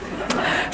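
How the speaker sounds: a short airy chuckle; teacher laughing at her husband's answer; soft breathy laugh. Real, not acted. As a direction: laugh under the breath at the joke.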